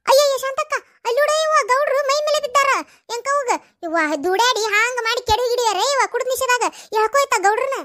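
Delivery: high-pitched, flustered alarm; alarmed outcry. Acted. Onlooker seeing the drunk headman fall on the body, alarmed. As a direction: call out in a high fluster for help.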